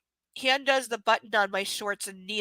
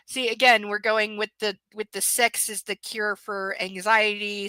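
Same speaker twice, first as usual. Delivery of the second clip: ordinary delivery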